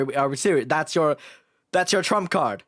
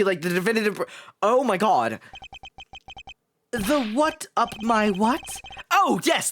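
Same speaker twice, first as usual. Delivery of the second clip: ordinary talk, nothing marked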